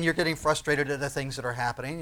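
ordinary delivery

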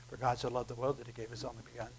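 quick, soft, flat recital of the verse; flat rushed reading. Shown as a demonstration. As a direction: rattle it off without feeling, hushed. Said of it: "I don't believe that he was saying that".

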